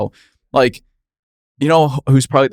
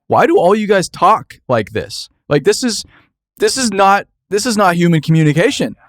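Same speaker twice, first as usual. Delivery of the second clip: exasperated disbelief; 9 semitones higher than usual. Retold or imitated. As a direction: rant in disbelief at how lawyers talk. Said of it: "It's like".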